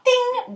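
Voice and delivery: high-pitched little chime; ping out a high pop-up sound effect; imitated ting. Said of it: "ça fasse genre". (petit « ting » aigu de clochette)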